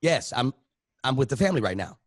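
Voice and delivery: ordinary talk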